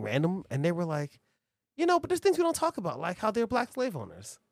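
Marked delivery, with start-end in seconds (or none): 1.8-4.4 high, fast sneering quote; high mocking voice. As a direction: squeak it out like a smug troll. Mocking quote, pitch jumps high.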